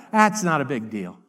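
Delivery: acted low, offhand brush-off; dismissive shrug. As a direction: drop the voice low and wave it away. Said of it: "you don't think it's a big deal".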